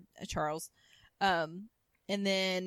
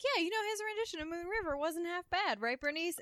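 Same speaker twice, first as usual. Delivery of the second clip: Mary Jo voiced; 10 semitones higher than usual.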